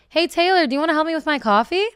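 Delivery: a loud, bright, showy ask; pointedly out loud. Retold or imitated. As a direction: call it out so the boyfriend hears. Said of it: "I'm purposely saying out loud".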